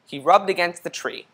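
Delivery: usual speaking voice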